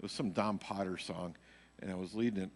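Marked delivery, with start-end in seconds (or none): none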